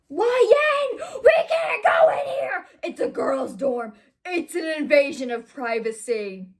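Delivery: high, loud overacted yell easing off; hammed-up shout. A narrator's reading. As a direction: holler in over-the-top outrage, then come down. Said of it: "Ron shouted".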